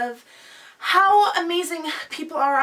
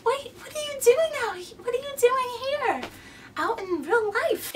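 surprised; 10 semitones higher than usual. Acted. Skit character surprised.

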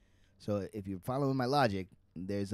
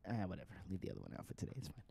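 ordinary delivery

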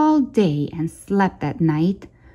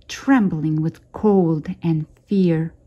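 ordinary delivery

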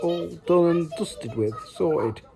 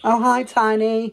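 high, louder, slow hello; higher and louder chirpy hello; sing-song greeting; 7 semitones higher, 6 dB louder, slower than usual. Real, not acted. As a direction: call out hi across the room. Greeting friend on phone.

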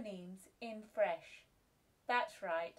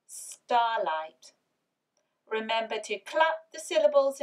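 louder instructions; 9 dB louder than usual. Real, not acted. Teacher sets writing task with loud emphasis. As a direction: say it louder to the class. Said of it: "set the writing task".

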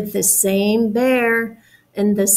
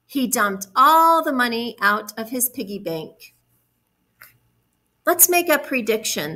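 ordinary delivery

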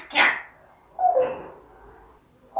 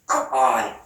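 low praise; faster than usual. Real, not acted. Praising the bird.

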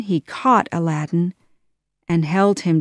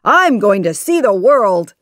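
louder, excited exclamation; excited cry; 3 semitones higher, 7 dB louder than usual. A narrator's reading. Freed genie announces travel plans, loud and excited. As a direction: announce it in high spirits. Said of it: "the genie cried".

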